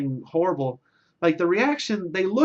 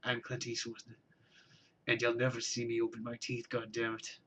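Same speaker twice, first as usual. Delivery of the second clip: much softer, words through barely opened teeth; Clint Eastwood; 2 semitones lower, 10 dB quieter than usual. Retold or imitated. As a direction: murmur it through clenched teeth. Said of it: "the extent of my Clint Eastwood impression".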